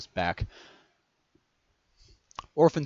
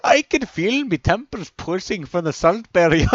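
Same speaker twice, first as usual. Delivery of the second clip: loud, high-pitched mock Irish; hammed-up Irish accent between laughs; 10 semitones higher, 9 dB louder than usual. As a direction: exaggerate a joking Irish accent. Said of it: "I had to do an Irish accent".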